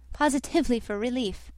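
ordinary delivery, nothing marked

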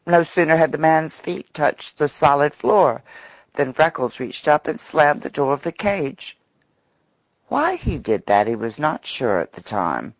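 plain reading voice

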